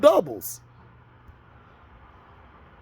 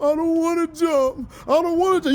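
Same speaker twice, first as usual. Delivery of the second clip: pleading student voice, a touch higher; imitating a scared student; 3 semitones higher than usual. Quoting Lean On Me, Sams pleading with the principal, mock scared. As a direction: beg not to, voice pulled back and pleading. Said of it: "What did my boy say on Lean On Me?".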